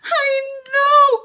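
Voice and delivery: loud, high drawn-out wail; hammed-up whiny despair; dismayed groan. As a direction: groan it like a kid in trouble. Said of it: "groaned Gabby".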